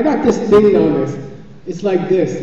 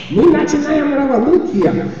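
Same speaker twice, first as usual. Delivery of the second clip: much higher, thrilled announcement in Russian; excited caller; 10 semitones higher than usual. Retold or imitated. Bulgarian lady phones the speaker, all excited. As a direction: burst out high with the big news. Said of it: "she's all excited".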